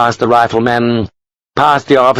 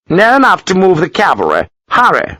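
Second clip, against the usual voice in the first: dismissive Gibbons; 10 semitones higher than usual. A narrator's reading. Gibbons brushing Sharp off.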